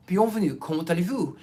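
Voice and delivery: French greeting in a convincing French accent. Shown as a demonstration. Host greeting the public in French, French accent. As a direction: sound fluently French. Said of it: "I sound French".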